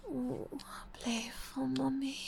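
normal talking voice

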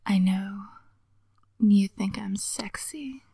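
slow whisper close to the ear; right in ear. Acted. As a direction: lean in and whisper it. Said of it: "I'm gonna whisper in your ear".

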